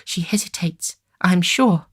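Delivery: high, hopeful insistence; eager hope. A narrator's reading. Young lady urging the gentleman, hopeful. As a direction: press the hope.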